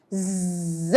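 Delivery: ز buzzed quietly through teeth; buzzing z. Shown as a demonstration. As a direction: press ز out softly. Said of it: "ایک دفعہ پھر بتاتی ہوں".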